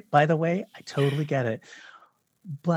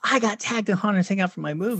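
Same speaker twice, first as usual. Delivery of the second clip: higher, quick excited quote; retold excitement; 5 semitones higher, faster than usual. Filmmaker retelling being tagged about his movie, mock-excited. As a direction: gush quickly, imitating your past self. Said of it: "I'm so excited".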